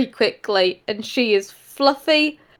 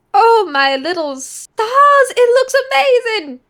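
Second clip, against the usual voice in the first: 1 overjoyed; 11 semitones higher, 4 dB louder than usual. Acted. Mother touched by breakfast.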